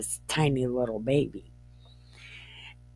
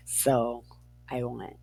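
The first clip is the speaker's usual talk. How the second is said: ordinary talk, nothing marked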